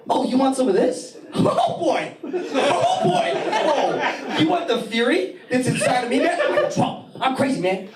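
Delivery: high, laughing mock madman; acting crazy. Shown as a demonstration. Comic demonstrating crazy talk to avoid a fight, hammed-up. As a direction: play a lunatic to scare off a fighter. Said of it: "you gotta get crazy".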